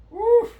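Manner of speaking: high whoop. Real, not acted. Cheering after hard set.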